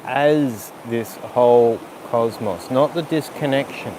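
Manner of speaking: calm yoga teacher guiding a breath sequence; guided breathing cues. Acted. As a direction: talk them through each breath gently. Leads breathing practice.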